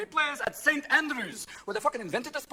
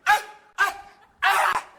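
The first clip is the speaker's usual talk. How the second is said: much higher loud grunting cries; mock tennis serve grunts; 8 semitones higher, 5 dB louder, slower than usual. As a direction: grunt each hit like a tennis player. Williams sisters grunting.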